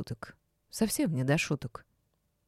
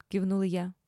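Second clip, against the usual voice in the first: usual speaking voice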